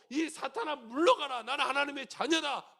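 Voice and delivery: loud bold command with a laugh; joyful rebuke of the devil; shouting at Satan. Shown as a demonstration. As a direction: shout it out boldly. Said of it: "막 외치는 거예요".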